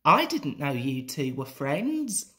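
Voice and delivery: plain reading voice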